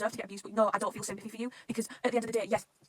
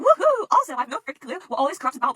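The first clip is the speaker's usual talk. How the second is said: high, loud, carefree cheer; excited whoop; 6 semitones higher, 12 dB louder than usual. Acted. Whooping character quote.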